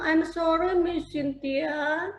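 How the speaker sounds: put-on sweet child. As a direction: say sorry like a sweet little kid. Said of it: "so sweet gitu ya".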